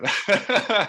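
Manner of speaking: high laughing burst. Real, not acted. Laughing at teammate's joke.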